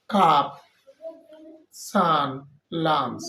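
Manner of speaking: teacher spelling out "কাপ", "সান", "লাঞ্চ" with a clear short "আ"; clear example words. Shown as a demonstration. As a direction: hold up "কাপ" "সান" "লাঞ্চ" one by one for the class. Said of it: "যেমন".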